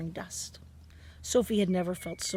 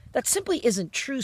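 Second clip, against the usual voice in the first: higher, louder, indignant outburst; angry cry; 6 semitones higher, 5 dB louder than usual. A narrator's reading. Sophie angrily contradicting the BFG about grown-ups, loud. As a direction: snap back at him, higher and louder. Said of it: "Sophie cried angrily".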